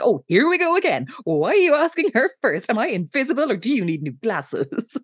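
high, exasperated mock huff; mock annoyed. Lila to the waiter who asks Jessica first, mock annoyed. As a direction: act put out at being ignored. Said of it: "Lila's getting really annoyed".

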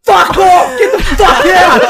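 high, loud furious shout, acted out; retold scream. Man on floor to strippers at door, retold screaming. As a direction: scream to drive them out. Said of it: "And I scream at them".

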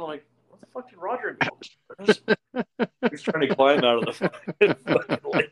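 high-pitched, laughing through the words; real laughing. Real, not acted. Telling of friend falling in lake, laughing. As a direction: crack up while telling it.

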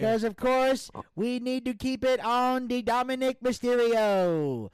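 high, loud campaign voice; presidential candidate voice. Pretend. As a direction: boom it like a candidate at a rally. Campaign speech continues.

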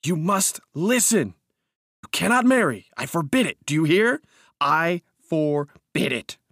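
high, loud, emphatic forbidding; furious command. A narrator's reading. Narrator forbidding cousin's marriage, furious.

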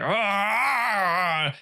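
long, high mock wail; mock scream. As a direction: let out a drawn-out, high, hammed-up cry. Imitating staggering Antichrist's cry.

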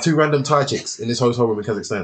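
ordinary delivery

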